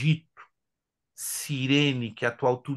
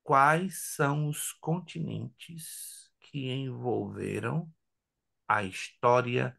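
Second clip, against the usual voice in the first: slow repeat; 4 semitones lower, 4 dB quieter, slower than usual. Shown as a demonstration. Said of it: "Vou repetir a pergunta, preste atenção".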